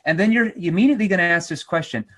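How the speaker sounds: ordinary talk, nothing marked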